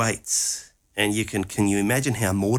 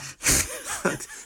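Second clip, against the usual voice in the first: high laugh; 4 dB quieter than usual. Real, not acted. Laughing at hired mourners.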